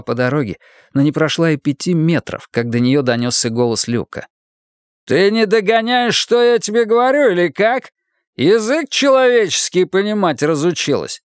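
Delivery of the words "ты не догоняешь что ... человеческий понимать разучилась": high, hectoring demand; angry taunt. A narrator's reading. Husband calling after wife on the road, berating. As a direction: berate her as if she were stupid.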